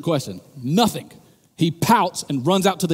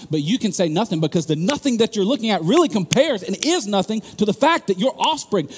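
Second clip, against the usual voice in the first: building to loud, higher preaching; 7 semitones higher, 5 dB louder than usual. Acted. Preacher confronting parents on the phone, building louder and higher. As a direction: climb into full preaching force.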